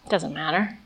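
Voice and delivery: imitating her teenage scorn, quiet and low; cold disdain. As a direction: toss it away with contempt. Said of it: "Just filled with disdain".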